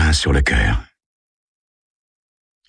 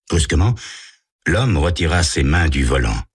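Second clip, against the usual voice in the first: normal talking voice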